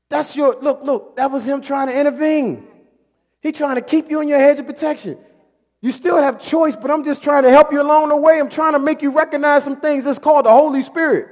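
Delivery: loud, driving preacher's voice; preaching at full force. Acted. Preacher urging the congregation to heed the Spirit, loud and driving. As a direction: press the point home to the congregation.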